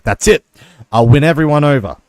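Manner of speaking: mock triumphant. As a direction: proclaim it like a grand revelation. Elon's retold epiphany.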